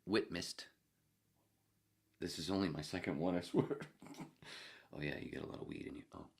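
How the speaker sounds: ordinary talk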